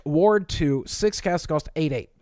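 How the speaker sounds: usual speaking voice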